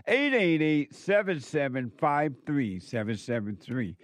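usual speaking voice

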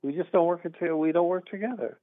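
ordinary delivery

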